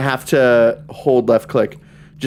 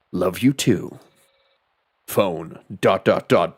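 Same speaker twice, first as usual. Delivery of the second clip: low, understated hero voice; David Hayter; 7 semitones lower, 3 dB quieter, slower than usual. Acted. Ending the phone call, low impression voice. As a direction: drop low for a mock-serious impression. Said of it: "David Hayter impressions".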